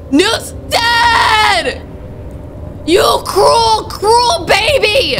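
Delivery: high crying yell; anguished shout. Real, not acted. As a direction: scream out grief and rage. Grief and rage at game baby.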